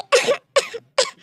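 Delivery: a strained, high cough that won't stop; harsh coughing. Acted. Boss coughs harder while his assistant offers water. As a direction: cough as if choking on it.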